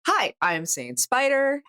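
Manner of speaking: usual speaking voice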